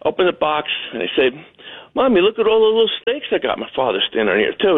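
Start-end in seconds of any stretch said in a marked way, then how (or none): 1.9-3.6 put-on high childish squeal to his mom; child showing off his snakes to mommy; little kid voice. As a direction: play the excited little boy. Child [name] shows mother snakes.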